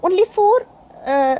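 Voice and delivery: usual speaking voice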